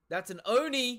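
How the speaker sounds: high, loud excited yell; excited shout. Real, not acted. Viewer spotting an Oni on screen, high loud excited shout. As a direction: shout it out as you spot the horns. Reactor spots Oni in clip.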